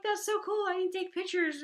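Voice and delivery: high, loud excited squeal; imitated gushing. Ladies gushing to mom about necklace, imitated excitement.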